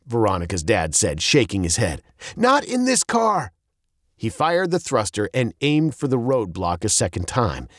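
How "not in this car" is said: high, emphatic dad voice. A narrator's reading. Dad defends his car to daughter, high and emphatic. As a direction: insist with raised pitch. Dad denies they will die.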